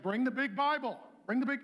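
mock-harsh God giving orders; hammed-up stern command; stern, bossy command. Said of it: "he didn't say it like that".

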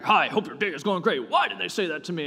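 imagined mad reply to a cheerful email; suspicious, offended reading. Shown as a demonstration. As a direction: reread it as if it were an insult. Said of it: "And you're, like, mad about it".

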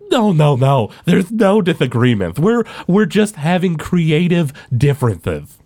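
smooth it over with hurried denial, then stretch the words; defensive Albus character. Acted.

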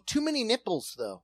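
high, light mock complaint; playfully voiced fussy critic. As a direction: pretend to be picky about the pillow. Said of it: "You're like".

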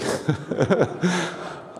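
high breathy laugh at himself; real laugh. Real, not acted. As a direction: laugh at the memory of bawling. Laugh while retelling weeping.

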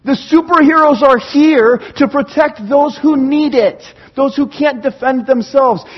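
high, loud rallying cry; excited cheer. Acted. Preacher voicing fans cheering superheroes on, excited. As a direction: proclaim it like a thrilled fan. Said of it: "No, we're going".

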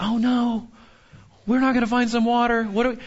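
a half-hearted panicked grumble; mock worry. Preacher imitates Israelites fearing no water, mock worry. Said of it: "And they're like".

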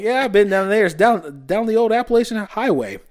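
high, hammed-up Southern accent; put-on Southern accent. Telemarketer relating to a client down the highway, hammed-up Southern accent. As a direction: play up the folksy Southern accent, pitched high. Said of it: "becoming this character".

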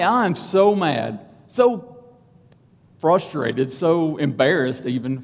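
ordinary talk

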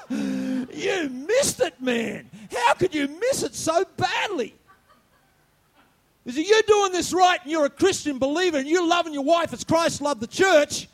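loud, high scolding; retold rebuke. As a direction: chew out the foolish husband. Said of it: "And I go".

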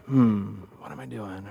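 low, soft pondering to himself; stalling dad. Acted. Dad who doesn't know what to say, pondering. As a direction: murmur it low as if stumped.

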